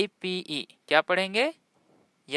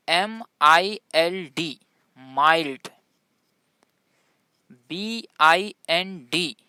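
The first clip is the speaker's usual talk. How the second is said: ordinary talk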